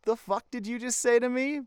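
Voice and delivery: high-pitched mock indignation; indignant comeback. Akaza turns round to Tanjiro, mock indignant. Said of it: "He's like".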